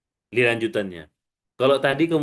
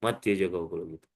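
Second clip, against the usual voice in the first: low, soft defeated mumble; mock unworthy murmur; imitated self-doubt; 8 semitones lower, 7 dB quieter than usual. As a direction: quote the doubter low and softly. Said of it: "yang ngomong".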